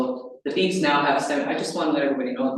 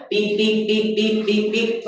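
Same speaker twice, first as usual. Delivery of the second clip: quicker, higher imitated beeps; fast close beeps; 5 semitones higher, slower than usual. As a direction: rattle off beeps close together. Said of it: "Now it's going".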